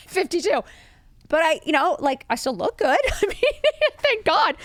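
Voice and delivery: high, giggly self-mockery; amused high squeal breaking into laughs; laughing. Real, not acted. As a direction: laugh through the words, pitch climbing. Laughs at her own age and looks.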